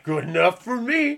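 drawn-out, self-satisfied mock quote; complacent quote. Acting out a complacent surgeon's remark.

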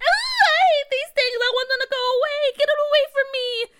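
high, loud, fast frantic cries; scared screaming. Acted. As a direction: scream in disgust and fear. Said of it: "McKinley continued to scream".

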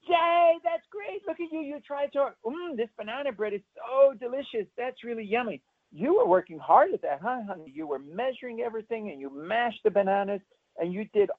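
put-on high sing-song praise for a proud kid; gushing praise. Shown as a demonstration. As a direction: cheer a child on with big excitement. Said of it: "You go".

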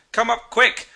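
high, loud call down to the cabin; urgent shout. A narrator's reading. Narrator shouting down the cabin to the captain, urgent and high. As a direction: shout an urgent summons below. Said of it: "I shouted down the cabin".

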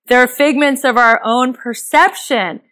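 usual speaking voice

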